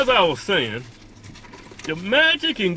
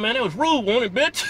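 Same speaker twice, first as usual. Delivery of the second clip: ordinary talk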